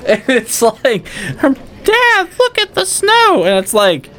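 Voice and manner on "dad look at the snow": mock kid voice. Mocking kid in movie scene.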